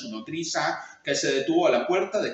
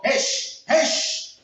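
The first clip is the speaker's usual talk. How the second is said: unvoiced hissed shoo; 11 semitones higher, 4 dB louder than usual. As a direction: hiss to chase off a rat. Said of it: "le chilló".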